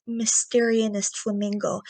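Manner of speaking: ordinary talk, nothing marked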